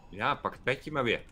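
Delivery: usual speaking voice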